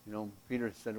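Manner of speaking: normal talking voice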